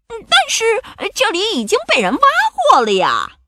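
very high, squeaky-pitched pirate lament; dismayed pirate. A narrator's reading. Pirate with a shovel finding the hole dug up, high dismay. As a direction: whine it high as a pirate sidekick. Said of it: "条纹裤本克举着铲子说".

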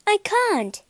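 high, startled refusal; shocked gasp. A narrator's reading. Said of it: "gasped Beauty".

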